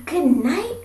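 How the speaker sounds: high, loud call downward. Acted. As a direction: call good night down under the bed. Child calls down under bed.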